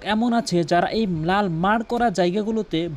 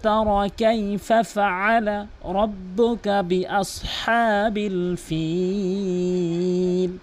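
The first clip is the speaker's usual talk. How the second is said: slow, melodic tajweed recitation; Quran recitation; 2 semitones higher, much slower than usual. Acted. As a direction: chant it slowly, holding the long vowels. (সুরেলা ধীর কোরআন তিলাওয়াত)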